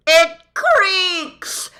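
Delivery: very high, loud joyous bellow. A narrator's reading. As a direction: bellow it on the edge of hysterical laughter. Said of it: "bellowed the din".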